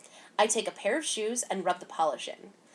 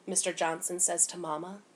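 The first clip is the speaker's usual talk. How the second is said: ordinary delivery, nothing marked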